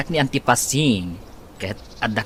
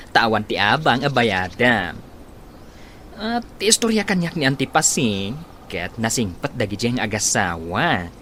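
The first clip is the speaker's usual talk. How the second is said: ordinary talk, nothing marked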